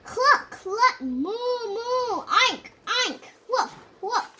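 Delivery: loud, high barnyard racket of clucks, moos, oinks and woofs; animals yelling. A narrator's reading. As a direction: yell every animal noise in a row. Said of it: "the animals began to yell".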